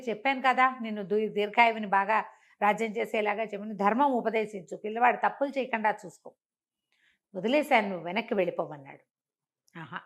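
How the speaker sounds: ordinary delivery, nothing marked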